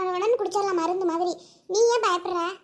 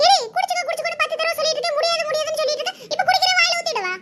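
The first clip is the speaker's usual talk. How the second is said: scolding mother; 6 semitones higher, faster than usual. Acted. Mother scolding refusing daughter.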